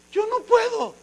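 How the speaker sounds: high, pleading whine; a reluctant man begging off; whiny refusal. Acted. Moses whining to God that he can't.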